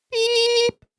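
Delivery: high squeaky beep noise; mock censor bleep. Bleeping out his own curse word.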